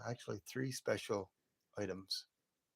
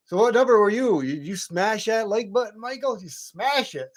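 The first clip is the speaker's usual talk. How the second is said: high, loud hyped-up call-out; playful hollering; 11 semitones higher, 12 dB louder than usual. Real, not acted. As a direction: holler it teasingly at a friend in the chat.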